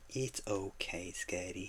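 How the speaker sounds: soft, slow comfort; soft reassurance. Real, not acted. Streamer softly reassuring a viewer. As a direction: soothe him gently, slowly.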